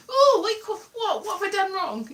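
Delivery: high, startled worried questioning; mock panic. Fearing she is being told off, high mock panic. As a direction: act out jumping at a telling-off. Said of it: "somebody's gonna tell me off".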